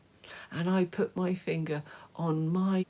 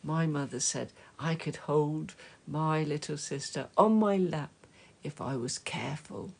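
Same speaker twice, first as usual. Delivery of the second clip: plain reading voice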